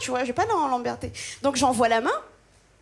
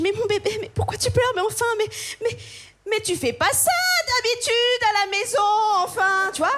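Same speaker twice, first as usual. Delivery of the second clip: performed cooing; 8 semitones higher than usual. Retold or imitated. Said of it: "je dis".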